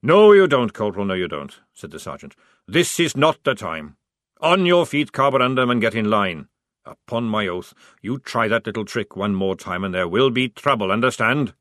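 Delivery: loud, commanding sergeant; stern sergeant. Acted. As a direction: shut him down firmly. Sergeant scolds corporal.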